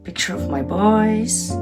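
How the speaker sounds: normal talking voice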